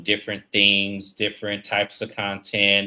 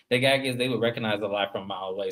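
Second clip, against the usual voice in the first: normal talking voice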